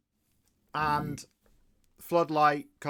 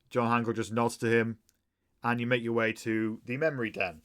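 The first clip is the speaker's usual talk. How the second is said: ordinary delivery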